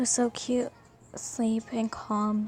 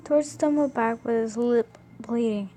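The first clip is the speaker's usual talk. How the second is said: ordinary delivery, nothing marked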